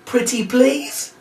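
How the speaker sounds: high, slow, hammed-up begging; drawn-out fake plea; mock pleading. Shown as a demonstration. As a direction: wheedle the kids like a sulky child. Begging the kids.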